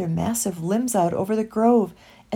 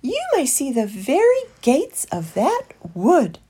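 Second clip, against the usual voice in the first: high, lilting bird voice; loud, birdlike lilt; calling dove; 6 semitones higher, 5 dB louder than usual. A narrator's reading. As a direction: call it out like a dove, swooping. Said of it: "she called".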